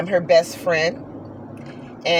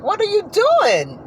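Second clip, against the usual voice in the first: much higher, squealing protest; mock squeal at a playful horse; hammed-up high-pitched protest; 13 semitones higher, slower than usual. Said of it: "I'm like".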